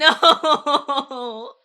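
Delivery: long, loud, high laughing no; drawn-out cry of protest; wailing protest. Real, not acted. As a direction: wail in comic dismay. Wailing at teasing about accent.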